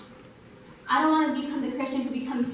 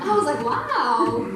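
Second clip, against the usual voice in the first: laughing loud through the words; 6 dB louder, slower than usual. Real, not acted. To the church, laughing at his own voice. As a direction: laugh in surprise at yourself.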